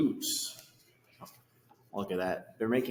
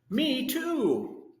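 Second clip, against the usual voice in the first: very high, tiny voice; little Joey voice; 13 semitones higher than usual. Retold or imitated. Baby kangaroo in the pouch agreeing, very high. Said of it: "the little Joey that says".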